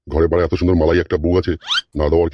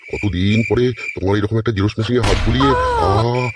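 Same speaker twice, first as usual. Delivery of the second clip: slow, drawn-out blissful sighing; moaning pleasure; 2 semitones higher, slower than usual. Acted. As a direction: draw the words out in hammed-up bliss. Acted pleasure in seduction scene.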